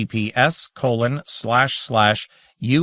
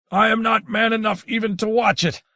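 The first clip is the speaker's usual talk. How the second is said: gurgled; 10 semitones higher than usual. A narrator's reading. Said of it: "Buford Strange gurgled".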